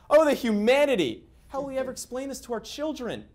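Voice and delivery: high, hammed-up wail of outrage; mock horror. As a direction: overplay the scandalized parent. Sarcastic outrage over student drinking.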